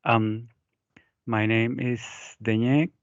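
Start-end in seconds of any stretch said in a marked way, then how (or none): none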